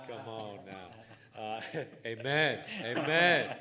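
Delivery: loud laughing amen, cheering along. Real, not acted. Guest cheering the host's point, loud laughing amen. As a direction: belt out amen through a big laugh.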